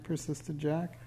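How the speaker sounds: ordinary delivery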